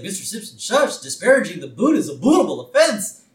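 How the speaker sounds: hint of a fussy lawyer shushing Homer; lawyer's voice. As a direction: lightly play the stuffy lawyer. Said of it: "he's like".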